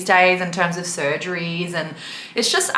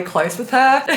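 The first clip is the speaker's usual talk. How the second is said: voice breaking; 5 semitones higher than usual. Real, not acted. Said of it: "I do not want to ruin my makeup".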